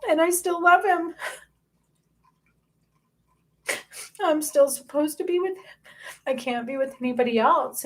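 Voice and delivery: fighting back tears as the pitch climbs; getting emotional. Real, not acted. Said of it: "I'm getting emotional".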